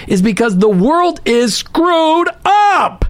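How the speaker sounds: slow, high, stressed screwed up; drawn out and emphatic, world is screwed up; preaching emphasis. Real, not acted.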